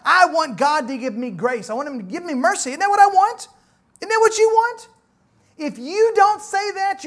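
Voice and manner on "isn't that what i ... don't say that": higher and louder pointed question; emphatic challenge. Real, not acted. Preacher asking the congregation what they want, emphatic. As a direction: press the question home.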